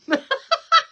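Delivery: loud squealing laugh; high shrieking laugh. Real, not acted. Host cracking up at her own joke, shrieking laugh. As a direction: burst out in a high cackle.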